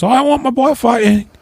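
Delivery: very high mock old mother; mother insisting her boy fights; Pikey's mum. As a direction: squeak it like a proud old mam. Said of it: "the Pikey's mama, she goes".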